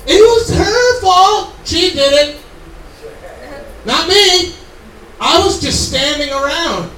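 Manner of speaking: loud, high, slow cry of blame; whiny blame-shifting Adam. Acted. Adam shouting at God to blame the woman. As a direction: point the finger and wail it was her. Adam blaming Eve, acted out.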